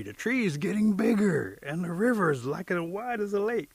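loud, high astonished cry; high-pitched panic as the world grows; rising alarm. Acted. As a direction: call out in mounting amazement. Shrinking warrior alarmed at growing world.

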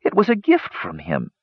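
understated distress; low, shaking voice. A narrator's reading. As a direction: insist on it while badly shocked. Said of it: "in a low, shaking voice".

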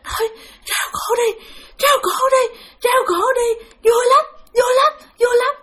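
high, strained, struggling to get each phrase out; laboured, halting speech. Retold or imitated. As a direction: imitate a tormented spirit speaking with difficulty. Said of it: "kể khó khăn lắm chứ không phải là trơn tru".

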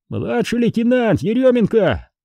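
high call of rank and name; shouted self-introduction. A narrator's reading. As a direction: report your rank across the distance. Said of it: "крикнул тот из-за зила". (выкрикнутый высоким голосом доклад)

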